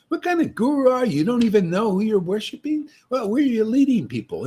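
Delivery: loud, high, fast challenge; preacher taunting rival gurus, loud and high; shouting taunt. Real, not acted. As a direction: call them out across the room.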